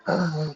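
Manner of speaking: audible mimed breath; acted gasp. Acts out breathing from the intercom.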